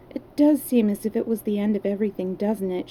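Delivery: ordinary delivery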